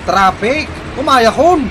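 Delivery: loud and high, straining to be heard on a bad line; shouting into the phone. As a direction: yell it down the phone over the traffic noise. (agpukpukaw iti telepono)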